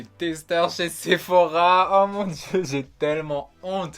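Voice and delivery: loud, slow exaggerated shame; dramatic embarrassment. Real, not acted. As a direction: overplay the embarrassment. Ashamed, exaggerated outburst.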